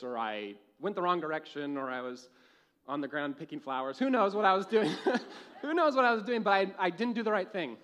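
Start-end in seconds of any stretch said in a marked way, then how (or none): 4.0-7.8 laughing at his own younger self; laughing while telling. Real, not acted. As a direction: talk through real laughter, louder. Laughs at own childhood blunder.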